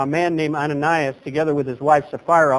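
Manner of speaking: ordinary talk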